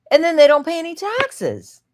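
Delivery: high, loud outburst of indignation; indignant. Real, not acted. Woman rants to viewers about churches and taxes, indignant.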